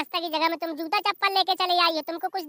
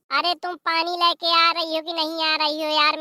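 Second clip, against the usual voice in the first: ordinary talk, nothing marked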